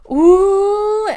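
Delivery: very high, loud ooh of pain; pained yelp. Acted. Galveston yelps as Deli pulls her hair, pained. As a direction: yelp as your hair gets yanked. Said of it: "Gal was making strange sounds".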